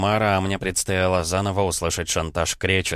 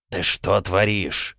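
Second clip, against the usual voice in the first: slow hissed rebuke; hissing; much slower than usual. Acted. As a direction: hiss it through teeth. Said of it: "зашипел алтарь".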